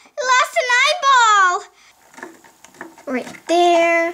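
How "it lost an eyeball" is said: high-pitched, dismayed outcry; shocked exclamation. Pretend. Toy loses an eye.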